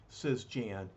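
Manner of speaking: ordinary delivery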